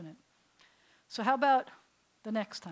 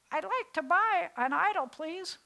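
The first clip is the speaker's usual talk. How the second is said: lady customer; 8 semitones higher, 4 dB louder than usual. Acted. Lady customer voiced in story.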